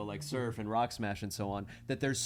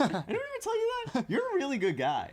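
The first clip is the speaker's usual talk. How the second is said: squeaky mock voice. Teasing compliment, high voice.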